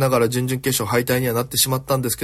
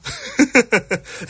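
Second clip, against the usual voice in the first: real high-pitched laughing fit; amused laugh; 13 semitones higher than usual. Real, not acted. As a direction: crack up at your own story. Amused by own backstage story.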